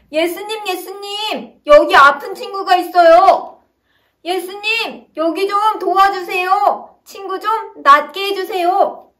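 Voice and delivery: high-pitched pleading call to a distant Jesus; calling out loud. Acted. Friends calling out to Jesus over the crowd, high pleading shout. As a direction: shout across the crowded house, begging. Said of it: "큰 소리로 예수님을 향해 외쳤죠".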